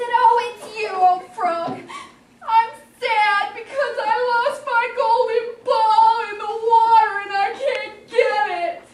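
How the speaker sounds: high, loud princess bawling; sing-song pretend weeping; mock tearful whine. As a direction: whine it out as a crying princess, hammed-up. Princess cries to frog over ball.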